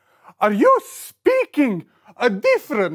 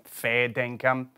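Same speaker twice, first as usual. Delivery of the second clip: put-on Australian accent; 13 semitones lower, 8 dB quieter than usual. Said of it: "I'm speaking Australian".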